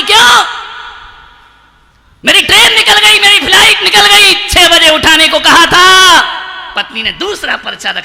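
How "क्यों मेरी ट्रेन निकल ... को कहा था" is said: high, loud outraged complaint; angry husband. Acted. Husband shouting at wife over missed train. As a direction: blame the wife furiously. उसने कहा कि.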